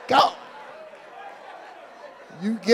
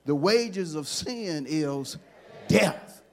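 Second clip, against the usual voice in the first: dramatic slow; much slower than usual. Acted. Slow dramatic sermon line.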